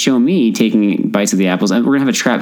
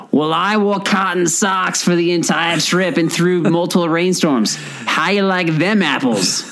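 slow, higher put-on Boston accent; playful Boston movie-quote accent; Good Will Hunting accent; 7 semitones higher than usual. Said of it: "that's Good Will Hunting accent".

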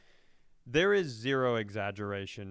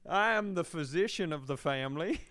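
mock Southern doctor; 5 semitones higher than usual. Doctor introduces himself as Cotton Eye Jeffrey.

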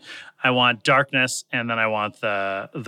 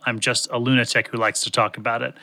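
ordinary talk

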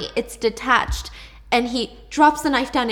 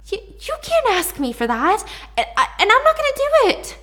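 much higher, louder cracking refusal; distressed refusal; 12 semitones higher, 5 dB louder than usual. Acted. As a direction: refuse her, voice climbing in distress. Seth refuses Holly's demand.

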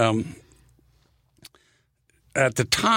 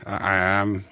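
slow, hesitant shark pitch; land shark voice; much slower than usual. Retold or imitated. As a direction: draw it out like a sly shark. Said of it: "did the shark voice".

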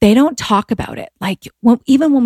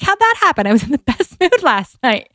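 mock surprise; 6 semitones higher than usual. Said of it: "you're like".

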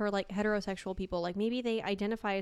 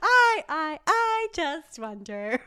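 much higher, slow, drawn out mock musing; mock grand pronouncement; 6 semitones higher, 5 dB louder, much slower than usual. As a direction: ham up a lofty thinker, stretching the words. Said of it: "You're like".

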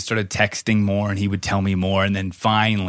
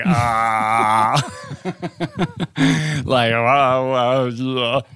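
stunned; 2 semitones higher, much slower than usual. Retold or imitated. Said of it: "And he's like".

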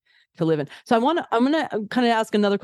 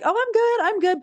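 high, airy "I'm good, I'm good"; breezy cool girl. Retold or imitated. Mimicking a carefree cool girl to the listener. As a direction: play the chill girl who's fine.

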